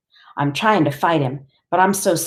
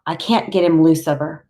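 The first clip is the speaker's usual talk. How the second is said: plain reading voice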